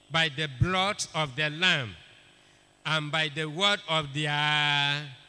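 loud, slow scripture reading; proclaimed scripture. Acted. Preacher reading the verse aloud to the church. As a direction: declaim each word of the verse.

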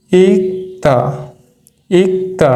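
ইকতা with a crisp k then a clear t, ik-ta, falling; teacher modelling the right form ইকতা; pronunciation demo. Shown as a demonstration. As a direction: say ইকতা slowly and correctly for learners. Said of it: "এটার উচ্চারণ কী করব?".

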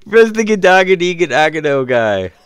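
baffled. Real, not acted. Baffled at skin.

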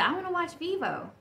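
imitating young son. Young son asking mom for his favorite movie, lightly imitated child voice.

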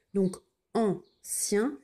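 ancien drawn out slowly, syllable by syllable; slow spelling voice. Shown as a demonstration. Teacher to pupils, slow careful ancien. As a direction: stretch ancien out for the class. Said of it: "teacher slows the tricky word".

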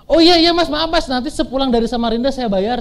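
high, loud opening, hastily apologetic; flustered debtor. Retold or imitated. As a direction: imitate someone caught out, blurting promises to pay. Debitur gugup berjanji akan membayar. (tiruan gugup minta maaf, suara tinggi)

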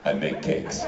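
deadpan smug baker; a smirking low mumble; smug cake guy. Pretend. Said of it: "to smirk".

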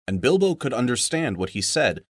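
plain reading voice

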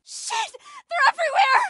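screaming as they swarm in; panicked shout. Acted. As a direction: yell it in terror. Swarmed by monsters.

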